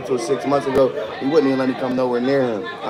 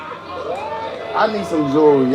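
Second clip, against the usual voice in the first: drawn out; 3 semitones higher, much slower than usual. Real, not acted. Drawn out request.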